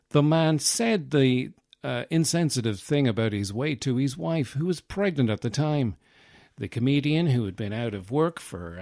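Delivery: news reporter voice. Pretend. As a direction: read it like a sober news report. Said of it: "now I sound like a news reporter".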